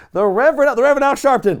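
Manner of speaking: loud, high announcer-style interruption; butting in loudly. Retold or imitated. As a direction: cut in and shout the name. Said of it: "Jericho just butts in".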